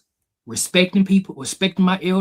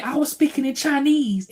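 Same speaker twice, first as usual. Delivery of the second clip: put-on high little-boy excuse; a frightened kid repeating his excuse; scared little kid; 11 semitones higher than usual. Boy repeating his excuse to dad, high kid voice. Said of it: "And I said".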